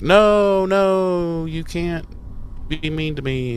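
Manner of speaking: whiny protest. Retold or imitated. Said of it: "she's all like".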